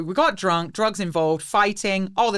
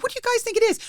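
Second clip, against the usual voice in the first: high-pitched mock alarm; retold question, voice shooting up high; panicked question; faster than usual. Asking friends about the mystery drugs, in high mock panic.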